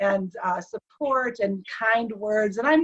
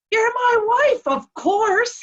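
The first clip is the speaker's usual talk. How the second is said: very high, louder, emphatic retort; indignant certainty; 12 semitones higher, 5 dB louder, slower than usual. Retold or imitated. Husband asked who his wife is, indignant certainty. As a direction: answer as if utterly obvious. Husband finds question obvious.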